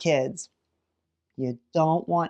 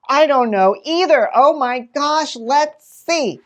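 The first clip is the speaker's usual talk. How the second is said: higher, louder thrilled wonder; excited suspense; 8 semitones higher, 6 dB louder than usual. Shown as a demonstration. Teacher hyping kids before the page turn, thrilled. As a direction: gasp along with the kids in suspense. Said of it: "build anticipation".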